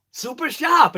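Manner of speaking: high-pitched Boston "Super sharp", no R's; Boston accent. Shown as a demonstration. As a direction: let "Super sharp" come out Bostonian, supa shahp. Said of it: "It just comes out".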